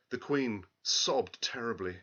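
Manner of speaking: plain reading voice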